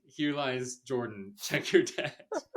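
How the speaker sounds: slow, laughing solemn epitaph; mock epitaph, laughing. Podcaster joking about the character's last words, laughing. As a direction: read it like a gravestone while cracking up.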